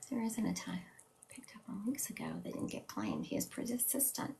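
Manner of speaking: breathy whisper, reading a comment to herself. Real, not acted. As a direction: murmur the comment, barely voiced. Reading viewer comment under breath.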